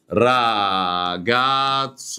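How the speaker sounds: "راجاتسو" very slowly drawn out; slow careful Italian; Italian model word. Shown as a demonstration. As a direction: stretch "راجاتسو" like an Italian native. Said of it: "يعني معناها ولد".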